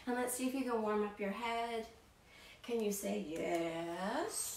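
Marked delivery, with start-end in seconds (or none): 2.6-4.6 slow prompt. Shown as a demonstration. Warm-up nodding head.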